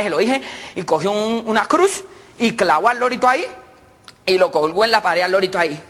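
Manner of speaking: normal talking voice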